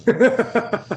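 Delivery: breaks into a high laugh mid-sentence; a real burst of laughter. Real, not acted. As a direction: laugh through the words at the memory. Laughing at own cane story.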